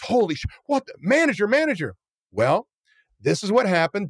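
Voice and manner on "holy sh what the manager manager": high, pitched-up gushing call; mock breathless excitement. Owner sees the Kobe beef and calls the manager, mock breathless excitement. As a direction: sound thrilled and out of breath.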